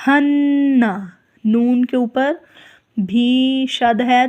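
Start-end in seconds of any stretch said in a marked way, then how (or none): 0.1-0.8 ہن with the nun held through the nose; nasal ghunna. Shown as a demonstration. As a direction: hum the nun of ہن. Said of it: "تو غنہ بھی کریں گے". (ہن میں غنہ)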